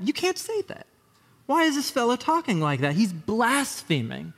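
high, offended religious teacher; a hammed-up scandalized lawyer; indignant scribe. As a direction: squeak out the outrage. Scribes' indignant thoughts voiced.

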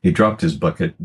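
usual speaking voice

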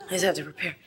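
soft, shaky nervous murmur. Acted. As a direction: whisper it with jitters. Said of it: "I'm nervous".

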